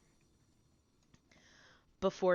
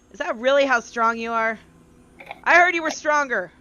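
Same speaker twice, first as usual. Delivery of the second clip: loud, higher, needling jab at his strength; exasperated taunt; 6 semitones higher, 10 dB louder than usual. Acted. Tabby mocks the hero who can't open a door, loud and higher. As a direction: scoff at the weakling, voice climbing. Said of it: "Tabby kinda lets out a little sigh, and she says".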